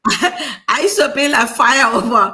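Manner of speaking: loud, laughing through the words. Real, not acted. Guest admits past devotion to her, loud and laughing. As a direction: laugh heartily while getting it out. Amused recollection, laughing with co-hosts.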